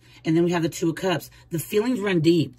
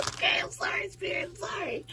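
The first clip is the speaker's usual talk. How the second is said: high, soft, breathless laughing apology; giggling apology; 9 dB quieter than usual. Real, not acted. Caught being lazy, apologizes to Spirit through giggles.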